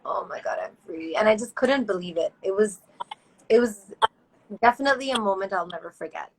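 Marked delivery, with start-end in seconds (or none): 0.0-1.2 hushed, near-whispered relief; retold tearful disbelief. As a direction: relive it softly, slipping into a whisper. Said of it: "froze and I started crying".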